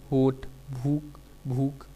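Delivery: normal talking voice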